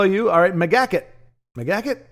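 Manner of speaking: ordinary talk, nothing marked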